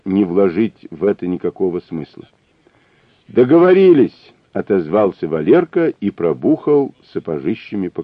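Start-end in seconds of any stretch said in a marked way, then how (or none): 3.3-4.3 high, loud parting shout; storming off shouting. A narrator's reading. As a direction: shout it while stomping away.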